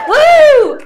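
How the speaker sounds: very high, loud woo; crowd hype cheer. Real, not acted. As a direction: pump up the crowd. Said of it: "ready for some more comedy".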